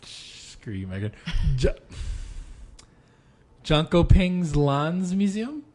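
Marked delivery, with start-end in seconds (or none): 3.6-5.6 slow, careful try at Jönköpings Lands Museum; sounding out Jönköpings Lands Museum syllable by syllable; careful Swedish attempt. Shown as a demonstration. Reading a Swedish name for a teasing cohost, slow and careful. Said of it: "I wanna see you butcher this".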